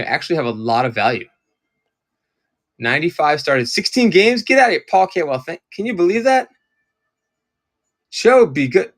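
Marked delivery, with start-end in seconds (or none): 2.8-6.5 shocked at the stat; sudden burst of disbelief. Real, not acted. Shocked at card stats.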